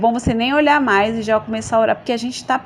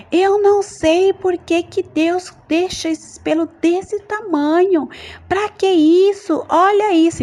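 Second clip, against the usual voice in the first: high-pitched, exasperated tirade; irritated ranting; 10 semitones higher, 4 dB louder, slower than usual. Retold or imitated. Woman in the bathroom railing irreverently at God, furious. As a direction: complain angrily, pitched way up and drawn out. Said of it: "muito nervosa, totalmente irreverente diante de Deus, eu falava assim".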